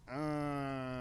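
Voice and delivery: long, drawn-out doubtful "uh", held level; soft, hesitant mock groan on one note; reluctant uh. Film fan imagines hearing of a Tarantino Star Wars, reluctant uh. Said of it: "I'd be like".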